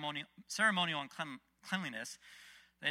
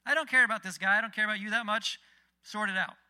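louder, higher, exasperated brush-off; dismissive Pilate; 5 semitones higher, 10 dB louder than usual. Retold or imitated. Roman governor waving off the accusers, exasperated. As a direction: act out an official who won't be bothered. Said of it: "Pilate, again, the Roman guy".

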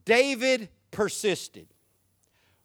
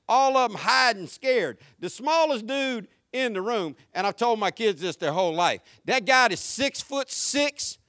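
usual speaking voice

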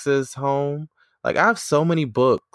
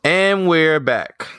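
big cheesy return from break; corny announcer; 7 semitones higher, 5 dB louder, slower than usual. Acted. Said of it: "the corniness of it all".

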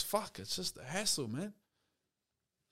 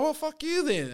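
much higher snappy comeback; mock retort; 10 semitones higher than usual. Answering the rude fan, high cheeky retort. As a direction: toss it back high and cheeky. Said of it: "And I was like".

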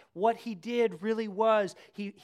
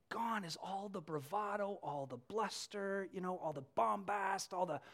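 ordinary delivery

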